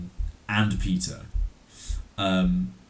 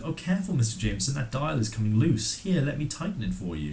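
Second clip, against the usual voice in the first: higher-pitched, put-on feminine fussing; voicing helpful Betty; 7 semitones higher than usual. Betty covering her sabotage of Jameson, mock helpful. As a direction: play a sweetly over-helpful secretary.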